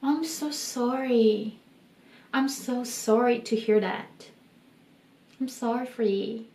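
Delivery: "I'm so sorry" said gently to comfort; sympathetic, soft. Shown as a demonstration. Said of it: "이렇게 얘기하고 싶으면".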